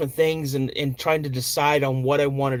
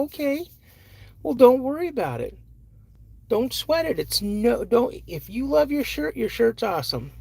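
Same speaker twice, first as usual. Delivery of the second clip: higher, gentle encouragement; reassuring dad; 9 semitones higher than usual. Retold or imitated.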